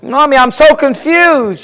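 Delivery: high, mock-tearful little girl; acted childish distress; crying child. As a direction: whine it like an upset child. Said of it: "she's crying".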